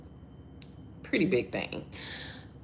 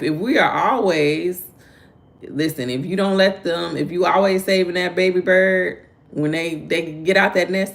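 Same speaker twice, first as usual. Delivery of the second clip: louder, raised preaching to parents; 3 semitones higher, 6 dB louder than usual. Real, not acted. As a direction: press the point like a sermon.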